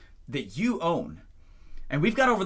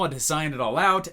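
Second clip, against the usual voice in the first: usual speaking voice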